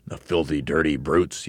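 usual speaking voice

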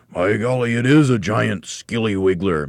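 mock alarm. A narrator's reading. Mr. Twit confirms the beast to his wife, mock alarm.

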